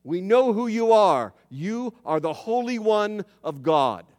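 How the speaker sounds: demons crying out, loud and urgent. Retold or imitated. Preacher voicing demons crying out to Jesus, loud. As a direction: call it out loudly, like a cry of recognition. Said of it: "cry out with a loud voice".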